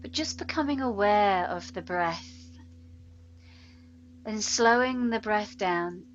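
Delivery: unhurried meditation voice with long pauses; guided meditation. Real, not acted. Yoga teacher settles students into breath awareness, guided meditation. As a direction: guide them gently into steady breathing, letting words linger.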